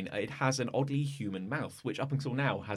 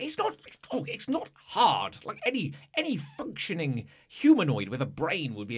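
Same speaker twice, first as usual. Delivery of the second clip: ranting; 6 semitones higher than usual. Acted. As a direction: break character to complain about their stupidity. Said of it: "ranting and raving a little bit".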